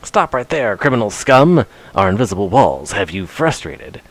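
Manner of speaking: lightly voiced game guard, badly faked; Oblivion guard. Streamer quoting a guard to a criminal, half-successful guard voice. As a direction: attempt a stern video-game guard catchphrase. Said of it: "I can't do a good, um, Oblivion guard voice".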